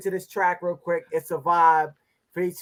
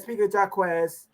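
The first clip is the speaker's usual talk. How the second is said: normal talking voice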